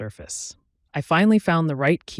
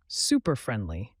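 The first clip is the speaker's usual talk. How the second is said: ordinary delivery, nothing marked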